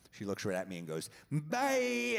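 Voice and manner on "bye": high, stretched-out dismissive goodbye; long high-pitched parting jab; mock farewell. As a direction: sing out a curt send-off, imitating her. Retold woman leaves, slams the door.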